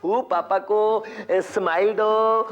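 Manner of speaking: put-on slow, sing-song baby talk; cooing posh mother. Rich mother cooing to her baby in English. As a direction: coo at the baby like a fancy mom. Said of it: "प्यार करती है English में".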